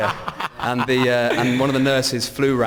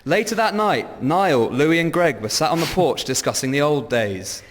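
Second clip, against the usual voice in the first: story narration; 4 semitones higher than usual. Acted. Narrates the script scene.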